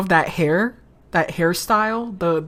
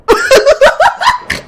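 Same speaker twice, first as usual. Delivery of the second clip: high squealing laugh; 9 dB louder than usual. Real, not acted. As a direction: burst into a high-pitched giggle. Laughs at the shock.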